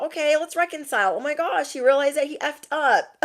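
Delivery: high, quick mock-hopeful voice; naive delight. Imitating her past self welcoming the ex back, naive delight.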